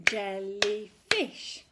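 "Jellyfish" slow, syllable by syllable, loud; a teacher clapping out a word for children; clapped syllables. Shown as a demonstration. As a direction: clap out "Jellyfish" in beats. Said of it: "Your turn".

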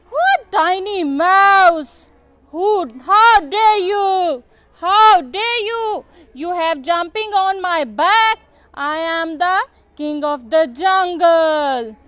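offended lion, heavy and loud; indignant lion. Acted. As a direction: boom it out as the king of beasts. Lion scolds the mouse.

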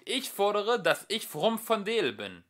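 put-on young philosopher yelling; shouting. A narrator's reading. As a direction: yell it as a demand. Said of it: "schrie der Jüngere".

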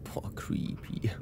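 whispering. Real, not acted. Reacting to creepy clip.

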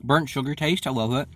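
speaking between bites; talking while chewing. Real, not acted. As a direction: mouth partly full. Seen 0: pursed, working lips mid-clip; chewing seen before and after.

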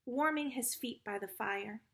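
plain reading voice

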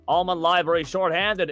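normal talking voice

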